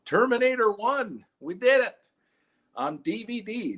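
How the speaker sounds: high, slow triumphant character voice; triumphant weird guy. Retold or imitated. Comic playing the DVD-bin guy finding Terminator. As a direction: crow it like a big win. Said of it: "He's like".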